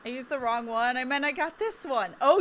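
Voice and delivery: ordinary talk, nothing marked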